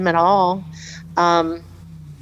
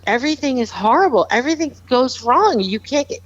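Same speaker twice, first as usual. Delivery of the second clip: higher and faster, spilling out despair; desperate teen; 7 semitones higher, faster than usual. Retold or imitated. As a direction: rush it out like a panicked teenager. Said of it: "how desperate he was".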